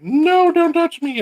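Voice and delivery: very high slow squeaky cat voice; high drawn-out prissy cat voice. Retold or imitated. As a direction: play the cat refusing to be touched. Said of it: "He was like".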